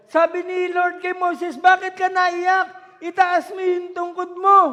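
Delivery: loud, high fiery preaching, shouting God's command; preaching at full force. Acted. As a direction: thunder it to the congregation like a revival preacher. (malakas at maalab na pangangaral)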